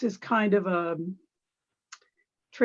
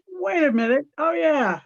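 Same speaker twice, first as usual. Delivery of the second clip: higher, louder quoted double take; acted-out reaction; 7 semitones higher, 5 dB louder than usual. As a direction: act out a sudden second thought, pitched up. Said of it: "And I'm going like".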